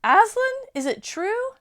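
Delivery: high, loud alarmed question; alarmed queen. Acted. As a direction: demand it in sudden fear.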